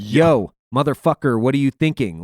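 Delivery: mock-angry chewing out; retold yell. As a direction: raise the voice, demanding an answer. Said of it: "I start yelling".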